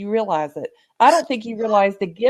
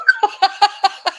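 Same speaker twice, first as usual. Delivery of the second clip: high burst of delighted laughter; real laugh; 10 semitones higher, 4 dB louder than usual. Real, not acted. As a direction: laugh out loud at your own excitement. Laughs after praising the host.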